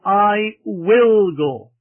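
slow, with "will" drawn out and loud; stress on WILL. Shown as a demonstration. Preacher showing the bride was positive, stress on "will". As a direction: lean long and hard on "will", then let "go" fall. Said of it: "laying the emphasis on the will".

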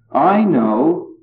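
"I" punched high, falling into "know"; emphatic high "I" to put the speaker first; stress on I. Shown as a demonstration. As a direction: lean hard on the word "I". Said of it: "so she starts with herself".

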